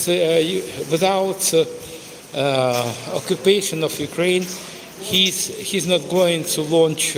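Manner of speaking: ordinary talk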